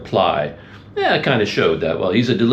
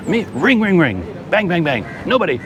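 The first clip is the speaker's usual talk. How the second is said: higher-pitched mock angry rant, clipped words; imitating an angry driver; 9 semitones higher than usual. Retelling the delivery driver's phone outburst, a high-pitched mock yell. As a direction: replay the impatient driver's outburst for a laugh. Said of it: "thought it was so funny where he's like".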